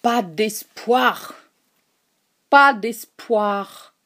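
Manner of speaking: very slow, dragged repetition; heavy, dragging despair. A narrator's reading. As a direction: torture himself with each word. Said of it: "d'une voix plus sourde encore, et plus basse et plus lente".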